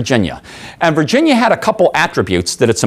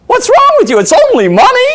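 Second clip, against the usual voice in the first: high, loud mock salesman exclamation; imitating amused salesman; 6 dB louder than usual. Car salesman laughing off the customer's price complaint, high mock amusement. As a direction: push it high, as if tickled by it. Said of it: "And he laughed again".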